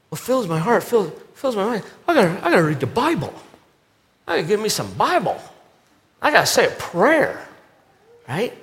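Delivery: high, fast, fretful churchgoer voice; mock frantic believer. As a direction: rattle off chores like a nervous checklist. Mocking believer's to-do list.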